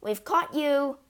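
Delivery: high, triumphant shout; yelled. A narrator's reading. Matilda bursts in brandishing her knife, yelling. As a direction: shout it at a cornered intruder. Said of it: "she yelled".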